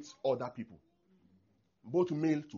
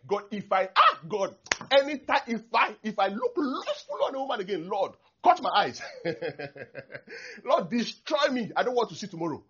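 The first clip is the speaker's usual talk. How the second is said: high, loud hammed-up pleading; mock desperate vow; 8 semitones higher, 8 dB louder than usual. Preacher mimicking a lustful believer's vow, loud mock pleading. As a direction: wail a melodramatic vow at God.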